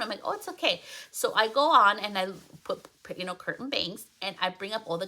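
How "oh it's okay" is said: bright, high reassurance to a client; reassuring. Retold or imitated. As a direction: soothe her, it's no problem. Reassuring client about missing phone.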